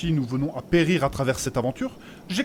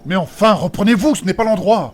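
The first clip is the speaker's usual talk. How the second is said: scolding; 5 semitones higher, 8 dB louder than usual. Acted. Scolding the kissing pair.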